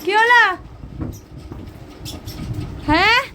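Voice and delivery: high, loud scolding shouts; loud, high telling-off shout; calling out loud. Real, not acted. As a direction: yell at the barking dog to stop. Yelling at noisy dog.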